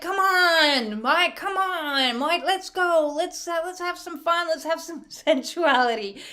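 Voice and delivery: high, wheedling husband coaxing; hammed-up smarmy pleading. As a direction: draw it out like a pushy seducer. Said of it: "He's smarmy as no get out".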